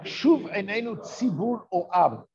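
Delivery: ordinary talk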